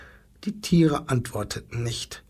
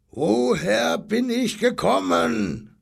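high, slow, drawn-out question; lion roaring the question; 9 semitones higher than usual. Acted. Lion asks the zookeeper, high and drawn out. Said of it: "Da brüllte der Löwe so lange".

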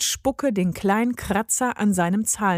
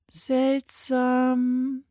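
pondering; 4 semitones higher than usual. A narrator's reading. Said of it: "grübelte ihre Mutter".

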